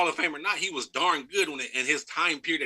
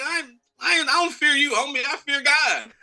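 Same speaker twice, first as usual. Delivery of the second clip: high, louder defiant voice; imitating Hopkins; 13 semitones higher, 6 dB louder than usual. Player quoting Hopkins defying a cornerback, high and loud. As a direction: put on the receiver's cocky, defiant squeak. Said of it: "And he was like".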